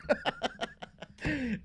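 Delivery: short high giggle; a quick amused laugh; giggling at herself. Real, not acted. Laughing at own burp.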